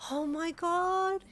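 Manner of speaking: very high, slow, drawn-out squeal; overjoyed and high-pitched. A narrator's reading. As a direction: squeal rising with tearful joy. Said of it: "you squealed".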